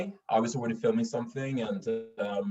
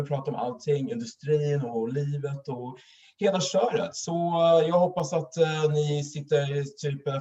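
hammed-up Swedish show presenter welcoming viewers; milk it like a live-stream host opening the show; playful over-the-top broadcast introduction; mock TV host intro; 2 semitones higher than usual. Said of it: "I'll milk it out a little bit. I'll just do the whole introduction".

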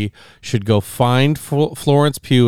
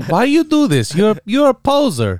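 high, teasing imitation; mock a show-off in a high put-on voice; mimicking movie sister; 9 semitones higher than usual. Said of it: "Flipping her hair over and over again".

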